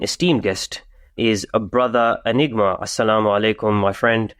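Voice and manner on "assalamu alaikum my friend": ordinary talk, nothing marked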